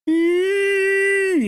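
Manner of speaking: put-on very high child's squeal; squeaky ee. Four-year-old caught swearing, high squeak. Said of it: "And she went".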